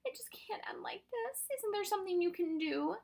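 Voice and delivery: high, breaking into whisper; tearful plea. A narrator's reading. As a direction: plead through tears in a small high voice. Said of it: "Huge tears rolled down Lindy's face".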